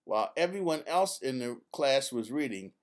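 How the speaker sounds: usual speaking voice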